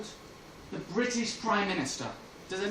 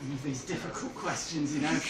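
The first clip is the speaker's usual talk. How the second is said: normal talking voice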